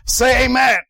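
high, loud call for an amen; shouting preacher. Retold or imitated. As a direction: imitate a preacher demanding a response from the crowd. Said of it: "this guy can really shout".

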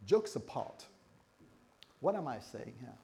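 ordinary talk

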